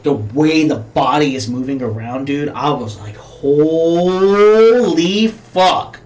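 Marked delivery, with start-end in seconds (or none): 3.2-6.0 very drawn-out, louder and higher exclamation; retold astonishment. Viewer reacting to the burning body scene, long stunned exclamation. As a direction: stretch the first word out in mock awe.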